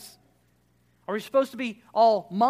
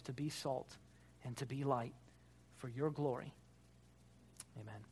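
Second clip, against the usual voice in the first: prayer; 4 semitones lower, 5 dB quieter than usual. Acted. Pastor praying over the congregation, soft and reverent. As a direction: lead them gently in prayer.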